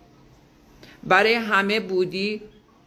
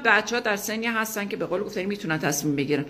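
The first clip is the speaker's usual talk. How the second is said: normal talking voice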